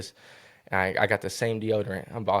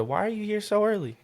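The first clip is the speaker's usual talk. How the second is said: surprised lady; 12 semitones higher than usual. Retold or imitated. Lady questions his early arrival.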